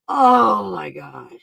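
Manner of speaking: slow, loud drawn-out gasp of words; awestruck. Real, not acted. Vlogger still overwhelmed, slow and amazed. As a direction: let it hang in awe. Awe continues.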